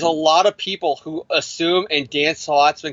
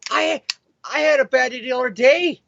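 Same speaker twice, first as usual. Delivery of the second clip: animated rant; 8 semitones higher than usual. Real, not acted. Ranting about his bad day.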